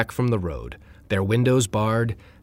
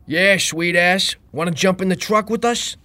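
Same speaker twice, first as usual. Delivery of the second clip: higher, louder rowdy catcall; catcalling shout; 10 semitones higher, 5 dB louder than usual. Acted. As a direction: holler it from a passing truck. Guys in truck shout at Heather.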